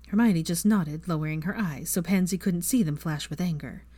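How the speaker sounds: ordinary delivery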